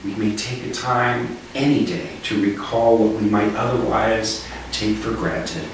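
normal talking voice

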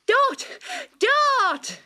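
bounding in breathless with news; excited calling. A narrator's reading. Kangaroo calls out to Dot, high and excited. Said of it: "she cried".

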